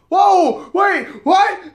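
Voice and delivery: high, loud startled yelps; mock alarmed outburst; imitated shocked panic. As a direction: mimic someone caught completely off guard. Retells his shocked reaction to cops.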